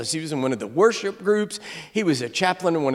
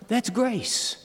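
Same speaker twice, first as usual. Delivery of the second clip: much softer, reverent statement; hushed grace; 4 semitones higher, 6 dB quieter than usual. Real, not acted. Preacher softly repeating the word grace, hushed. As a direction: let it land quietly.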